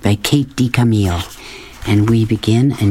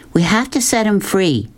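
higher, firm, sure of herself; loud and certain; 8 semitones higher than usual. A narrator's reading. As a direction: insist on freeing the tiger, no doubt in it. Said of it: "her voice loud and certain".

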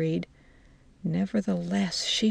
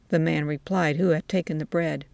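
usual speaking voice

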